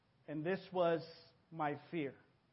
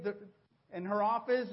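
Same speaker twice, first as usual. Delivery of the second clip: normal talking voice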